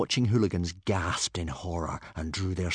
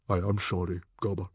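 solemn. A narrator's reading. Chief consoles Gobber over his dragon, solemn. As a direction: offer sympathy gravely.

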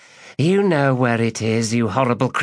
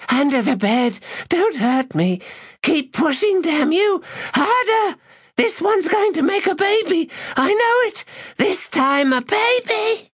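ecstatic cries. Acted. Woman climaxing, high voice.

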